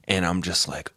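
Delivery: ordinary talk, nothing marked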